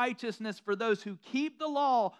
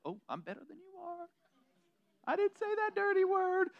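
high, prim holier-than-thou voice; prissy gloat; 9 semitones higher than usual. Retold or imitated. Preacher lampooning a holier-than-thou believer, prim and high. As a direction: sniff down at the sinner, prim and pleased.

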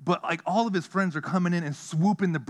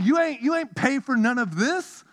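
mock scolding; 9 semitones higher, 5 dB louder than usual. Scolding the hummingbird.

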